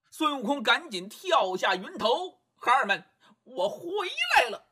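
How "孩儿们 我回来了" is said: put-on high, bright call to his children; high-pitched Monkey King greeting the monkeys. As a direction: announce your return joyfully.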